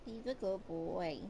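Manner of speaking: low, slow, tender praise. Real, not acted. Praising the dog softly.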